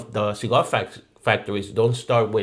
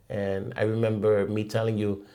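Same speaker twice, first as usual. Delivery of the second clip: ordinary delivery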